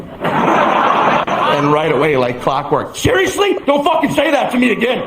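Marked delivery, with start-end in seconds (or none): 3.0-5.0 high-pitched, indignant scolding; exasperated roommate. Acted. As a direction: scold him like a fed-up roommate. Roommate snaps at repeated joke.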